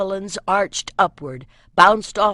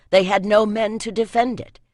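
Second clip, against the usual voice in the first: ordinary delivery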